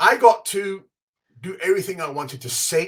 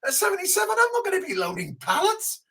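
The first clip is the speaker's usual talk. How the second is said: much higher, incredulous whine; mock exasperation; 13 semitones higher than usual. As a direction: sound put out by his stamina. Mock complaint about aging.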